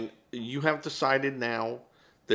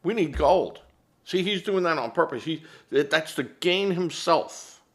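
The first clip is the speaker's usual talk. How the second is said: louder, higher mock outrage; mocking Democrats; 7 semitones higher, 6 dB louder than usual. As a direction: mimic a whining opponent, hammed-up. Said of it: "you'd have Democrats going".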